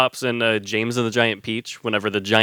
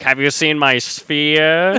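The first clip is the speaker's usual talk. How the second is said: Tim Curry; 2 semitones higher, slower than usual. Retold or imitated. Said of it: "And then Tim Curry's like".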